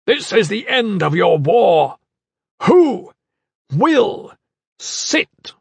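booming high roar, word by word; commanding bellow, each word hammered out. A narrator's reading. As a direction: thunder it slowly at a whole crowd. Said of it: "and roared".

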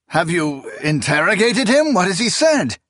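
near-shout of panic; almost shouting. A narrator's reading. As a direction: nearly shout it in alarm. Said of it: "The man almost shouted".

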